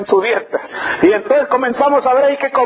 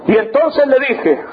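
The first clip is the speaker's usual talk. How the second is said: usual speaking voice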